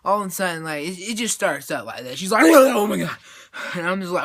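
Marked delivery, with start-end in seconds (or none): 2.5-3.2 loud, hammed-up gasp of alarm; mock startled wake-up cry; waking in panic. Girl waking from a nightmare, mock panic.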